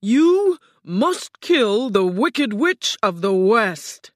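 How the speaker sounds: loud, high, slow booming order; commanding Oz. A narrator's reading. Oz orders Dorothy to kill the witch, booming. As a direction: boom out the order word by word.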